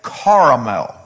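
CARAMEL drawn out with a broad "car" first syllable; kids' pronunciation. Retold or imitated. Kids quizzing dad on a word, CARAMEL said slowly as CAR-a-mel. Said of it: "How do you say caramel?".

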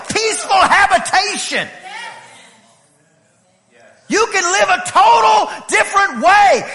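loud, high, driving sermon climax; full-force preaching. Acted. Pastor urging the congregation to a new way of living, full-force preaching. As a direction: preach it out, almost shouting.

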